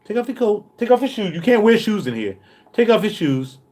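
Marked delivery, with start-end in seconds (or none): none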